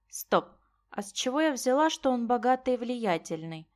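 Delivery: plain reading voice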